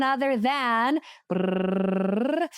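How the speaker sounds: a slow rolled brrrr like a drumroll; drumroll trill. Retold or imitated. Teasing the guest with a slow drumroll trill before the reveal. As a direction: stretch out a rolled-R drumroll for suspense.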